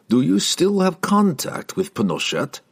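high-pitched, slyly probing question. A narrator's reading. Guildmaster leaning close to the wizard's image, asking slyly. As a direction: ask it slyly, with the pitch lifted. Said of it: "he asked the wizard slyly".

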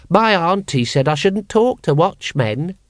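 put-on high, slow child's voice; a child solemnly repeating auntie's rule; small child. Small child answers Vimes.

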